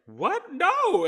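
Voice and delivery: high, loud mock shriek of alarm; shocked disbelief. Wife reacting to husband's October goodbye, mock shocked cry. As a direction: imitate a wife's stunned outburst.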